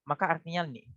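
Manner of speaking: ordinary talk, nothing marked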